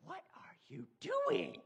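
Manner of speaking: high, slow squeal of shock; father finds his son throwing poop, high shocked squeal; shocked dad. Retold or imitated. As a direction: shriek it like a horrified dad. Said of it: "Dad's like".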